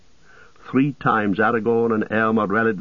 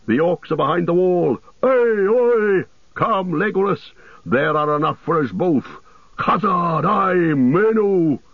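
high, ringing alarm call; rally a friend with a high war cry; dwarf's battle shout; 9 semitones higher than usual. A narrator's reading. Gimli calling Legolas to the fight, high battle shout. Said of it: "he shouted".

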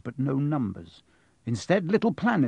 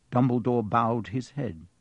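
usual speaking voice

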